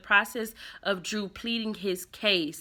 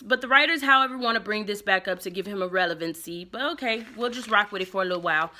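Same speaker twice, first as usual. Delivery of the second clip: ordinary talk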